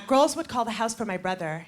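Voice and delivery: ordinary talk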